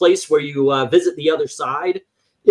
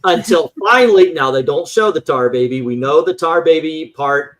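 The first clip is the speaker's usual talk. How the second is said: ordinary delivery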